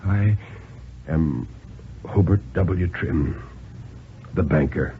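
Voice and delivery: soft, worn-out insistence; quiet weary old man. Acted. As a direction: say it softly, with the last strength.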